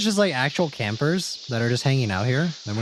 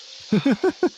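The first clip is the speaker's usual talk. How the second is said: high giggle. Real, not acted. Laughs at own impression.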